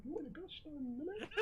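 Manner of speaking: very high, soft double take; mock suspicion. Player retelling confusion at mirrored powers, mock suspicion. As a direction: squeak it quietly as the penny drops. Acting out a slow realisation.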